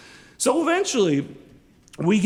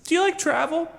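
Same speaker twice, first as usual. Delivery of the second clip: mock sweet girlfriend; 13 semitones higher than usual. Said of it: "And she's like".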